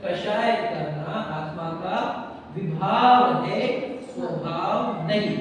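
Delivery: slow, deliberate, weight on Vibhav and नहीं; teacher spelling out विभाव है, स्वभाव नहीं; stressed slow repeat. Shown as a demonstration. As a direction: drill the rule word by word. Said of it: "समझ रहे क्या बोल रहा हूं मैं?".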